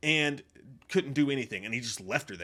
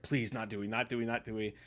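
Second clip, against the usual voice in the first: quiet pleading; 5 semitones lower, 7 dB quieter than usual. Retold or imitated. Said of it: "I was like".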